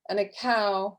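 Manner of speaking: normal talking voice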